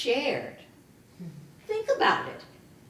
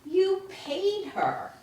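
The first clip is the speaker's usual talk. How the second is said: angry; 7 semitones higher, slower than usual. Acted. Angry retort to employer.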